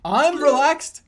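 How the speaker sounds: high, loud, defensive shout; unrelaxed yell. Pretend. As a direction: insist at a yell, anything but relaxed. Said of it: "You don't sound that relaxed".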